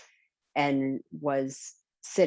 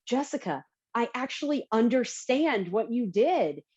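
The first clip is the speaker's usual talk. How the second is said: lightly voiced dad, pitched up; a hint of a delighted father; dad amazed he understood the abstract; proud dad quote; 9 semitones higher than usual. Dad quoted reading her paper.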